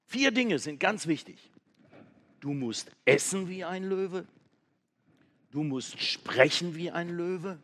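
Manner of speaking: excited monkey sharing his big idea; clever monkey. Acted.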